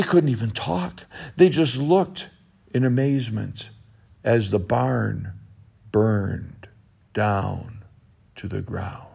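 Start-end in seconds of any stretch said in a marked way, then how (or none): 4.2-9.1 softer and softer, ending in a whisper; storyteller fading to a whisper as the barn burns down. A narrator's reading. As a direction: let the sentence die away into a hush.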